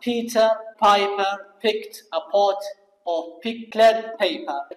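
very slow, word-by-word tongue twister; slow model reading. Shown as a demonstration. Teacher reads another hard phrase for students to imitate, slow model reading. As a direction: separate each word so learners can copy.

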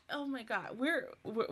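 normal talking voice

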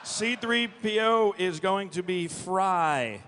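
ordinary delivery

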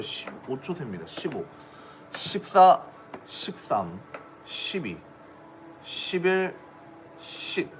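slow auction countdown, one number at a time; auctioneer. Acted. Auction countdown, one per second.

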